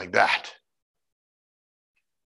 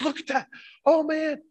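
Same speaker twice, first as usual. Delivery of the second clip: higher, awestruck mock gasp at the view; imitating amazed man. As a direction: play the Rainbow Man, amazed at the sky. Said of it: "He goes".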